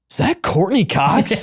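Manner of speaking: high-pitched mock disbelief; imitated surprised question. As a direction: imitate someone suddenly spotting a star, voice jumping up. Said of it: "looks up ... and just goes".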